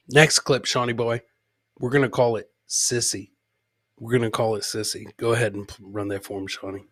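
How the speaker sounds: ordinary talk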